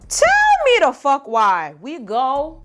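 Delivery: loud, high, drawn-out TELL; exasperated outburst. Real, not acted. Venting to viewers about friends gone to bed, exasperated outburst. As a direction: stretch out TELL in loud disbelief.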